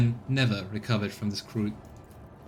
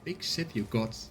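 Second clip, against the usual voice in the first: quiet; 7 dB quieter than usual. Shown as a demonstration. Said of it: "I don't know what was hard to say".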